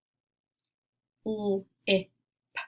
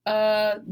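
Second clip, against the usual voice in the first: "u" punched louder as the peak of the word; stressed vowel; 6 dB louder than usual. Shown as a demonstration. Teacher to class, showing the middle vowel, stressed loud. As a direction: hit the vowel "u" hard, like the top of a roller coaster. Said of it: "really emphasizing that middle vowel sound".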